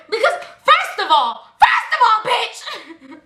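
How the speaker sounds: high, heated outburst with laughter; getting so mad. Retold or imitated. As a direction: build into a worked-up, half-laughing rant. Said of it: "I'm getting so mad".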